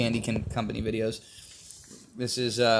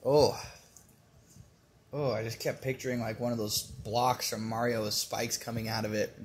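normal talking voice

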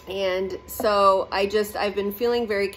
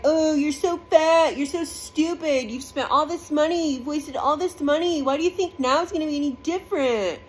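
higher, sneering devil voice that taunts and belittles; mocking devil voice; 7 semitones higher than usual. The devil taunts you after a setback, in a higher sneering voice. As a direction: put on a nasty inner devil picking you apart. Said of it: "And the devil's like".

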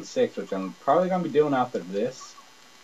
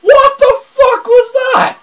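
much higher, very loud, slow yell; shocked shout; 15 dB louder, slower than usual. Real, not acted. Gamer yelling at the fighter's move, shocked. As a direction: bellow in disbelief.

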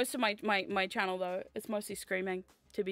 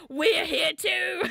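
imitating Celia; 5 semitones higher, 5 dB louder, much slower than usual. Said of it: "Celia's like".

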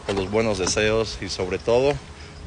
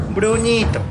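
much higher, louder call of the name; calling the dog; 10 semitones higher, 5 dB louder, slower than usual. Real, not acted. Vet calls out happily to his patient Bruno, high and loud. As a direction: sing the dog's name out high.

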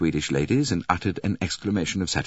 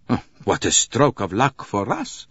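higher, delighted doctor's exclamation; excited exclamation; 5 semitones higher, 4 dB louder than usual. Acted. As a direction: exclaim at a lucky find. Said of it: "exclaimed the doctor".

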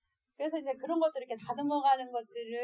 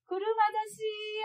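storyteller's cloud man; 10 semitones higher, 5 dB louder, slower than usual. Acted. Mother voicing scary cloud man.

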